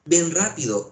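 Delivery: a sharp, urgent summons; commanding order. Shown as a demonstration. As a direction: bark Ven rápido like an order. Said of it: "imperativa o exhortativa".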